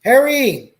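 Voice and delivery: a dad calling his son in; called out. A narrator's reading. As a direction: call across the house. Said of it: "he called out".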